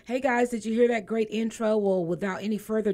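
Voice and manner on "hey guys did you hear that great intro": ordinary talk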